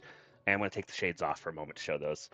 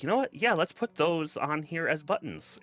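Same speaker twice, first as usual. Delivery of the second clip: excited high voice; 9 semitones higher than usual. Retold or imitated. Retelling own excited decision.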